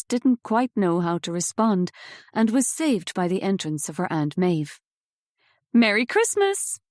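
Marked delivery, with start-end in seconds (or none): 5.7-6.8 high, cheery holiday greeting; bright greeting. Acted. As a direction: beam as you say it. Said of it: "a bright smile on her face".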